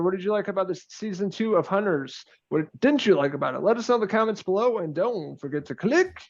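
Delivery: ordinary delivery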